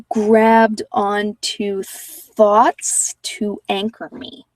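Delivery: ordinary talk, nothing marked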